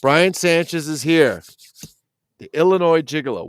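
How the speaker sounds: high, loud hype for a new arrival; excited announcement. Real, not acted. Host greets a viewer joining the live chat, loud and high. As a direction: call his name out like a host.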